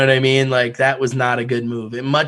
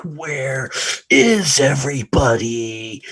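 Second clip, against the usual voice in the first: slow lost man; 3 dB louder, much slower than usual. Retold or imitated. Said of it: "he's like".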